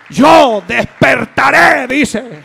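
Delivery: shouted preaching. Acted. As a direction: hit the peak of the sermon. Preaching climax.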